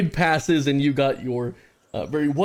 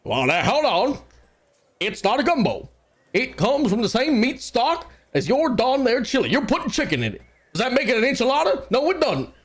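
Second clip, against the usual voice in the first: folksy chili cook; 11 semitones higher than usual. Acted. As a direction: argue like an indignant old-timer cook. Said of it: "Rashad says".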